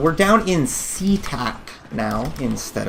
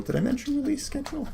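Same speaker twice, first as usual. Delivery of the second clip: high mock question; 11 semitones higher, 10 dB quieter, faster than usual.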